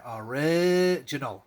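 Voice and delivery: "Original" slowly stretched in the middle; sounding it out. Acted. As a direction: teach "Original" slowly, holding the second syllable. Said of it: "trying our best to read this to you".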